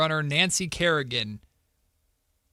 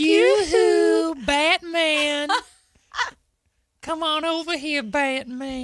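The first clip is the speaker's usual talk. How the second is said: higher sing-song calling voice; catcalling old lady; 8 semitones higher, much slower than usual. Acted. Old lady catcalling Batman, high sing-song. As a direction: lure him over with a sing-song yoo-hoo. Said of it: "that's like catcalling".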